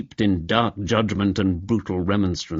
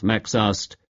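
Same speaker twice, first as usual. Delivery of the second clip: ordinary delivery, nothing marked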